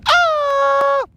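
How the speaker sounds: high falsetto grito yell; a quick high "ah" cry; imitated grito. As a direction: let out a Mexican grito. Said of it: "you're gonna hear everybody just go".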